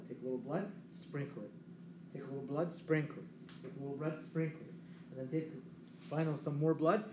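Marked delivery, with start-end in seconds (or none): 0.1-5.5 soft murmured ritual demo; quiet step by step demonstration; hushed demo. Shown as a demonstration. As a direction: murmur it as you mime sprinkling. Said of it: "sprinkle around like this".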